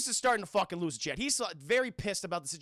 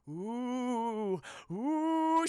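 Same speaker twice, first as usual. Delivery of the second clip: long drawn-out spooky "ooh"; hollow, held zombie moan like a ghost; ghostly ooh; 3 semitones higher than usual. Retold or imitated. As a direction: wail like a cartoon ghost. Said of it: "She sounds like a ghost".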